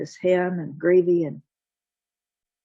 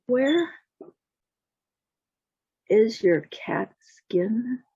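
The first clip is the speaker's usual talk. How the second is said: slow, raised, pointed question; slow confrontation; 4 semitones higher, much slower than usual. Acted. Jack confronts the miller's wife, slow pointed question. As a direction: leave a long pause after the first word.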